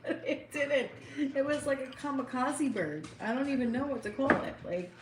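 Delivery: trying not to laugh at a sad story. Real, not acted. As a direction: giggle through the telling. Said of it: "You're laughing".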